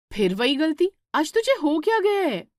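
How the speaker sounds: exasperated elder sister's rebuke; angry scolding. Acted. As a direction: snap at her for ruining the dough. Divya scolds Shweta again.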